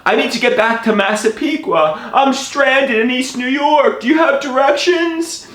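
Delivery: high-pitched, pleading panic; panicked plea. Retold or imitated. Stranded man asking an elderly woman directions, high panic.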